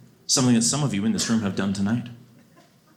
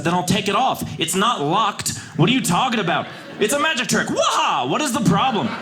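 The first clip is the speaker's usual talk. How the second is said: exasperated yell; 9 semitones higher than usual. Retold or imitated. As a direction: shout her logic down. Arguing with flight attendant.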